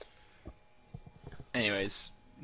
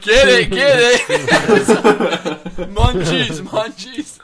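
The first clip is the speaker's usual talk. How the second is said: excited cheering; 10 semitones higher, 11 dB louder, much slower than usual. Pretend. Voicing mischievous gremlins eating.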